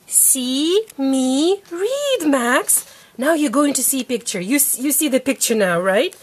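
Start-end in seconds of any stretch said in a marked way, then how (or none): 0.1-2.2 drawn out, word by word; slow reading aloud. Shown as a demonstration. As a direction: read it very slowly. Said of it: "You just read".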